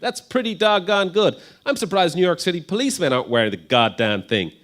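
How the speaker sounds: hammed-up American accent, punchy and loud. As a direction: play the Marine helmet expert with a big American accent. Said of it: "put on my American accent again for this".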